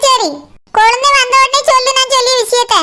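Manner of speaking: normal talking voice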